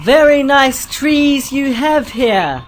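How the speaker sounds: slow, high, exaggerated politeness to aliens; overly polite. Explorer flatters the alien trees, slow and over-polite. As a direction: talk slowly as if to a foreigner. [name] speaks slowly to aliens.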